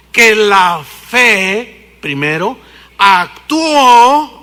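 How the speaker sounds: drawn out very slow and loud; very slow emphasis. Real, not acted. Preacher drawls the verse to the congregation, very slow. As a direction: stretch every word. Preacher stretches key phrase.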